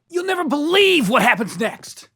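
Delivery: loud, high, overexcited teaser; hyped clickbait shout. Acted. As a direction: sell it like a viral video hook. Same teaser, loud high take.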